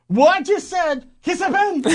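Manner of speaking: high, loud comic outburst; mock indignant shout. Co-host mocks boss's complaint about long break, mock shout. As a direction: burst out high and loud, hammed-up.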